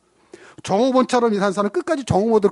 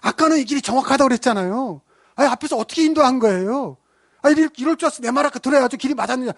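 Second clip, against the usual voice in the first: higher, whiny irritated scolding; mock angry complaint; 7 semitones higher, faster than usual. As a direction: play the blaming woman. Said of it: "화를 내더라는 거죠". (화내며 징징대는 흉내)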